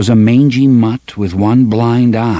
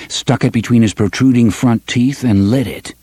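plain reading voice

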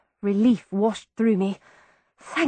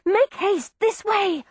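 higher, hurried urging; urgent; 8 semitones higher, slower than usual. A narrator's reading. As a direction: hurry him along. Fleeing with wounded brother.

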